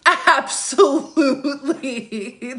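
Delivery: high, drawn-out laughing ABSOLUTELY; laughing emphatic repeat. Real, not acted. Host confirming fake relatives message her, laughing emphatically. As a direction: stretch "Absolutely" long and high through a laugh.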